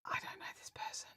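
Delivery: whispered inner panic; hushed, voiceless confession; secret aside. Retold or imitated. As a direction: whisper it like a private thought. Secret thought during the chat.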